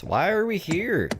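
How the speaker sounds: whiny. Real, not acted. Bored friend complaining on the trip.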